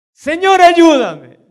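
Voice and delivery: loud, high begging cry; high loud pleading cry; woman's desperate plea. Retold or imitated. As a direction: cry out for help. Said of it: "Ella insiste".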